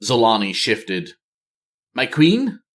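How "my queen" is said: questioning soldier. Acted. Zolani prompts the silent queen.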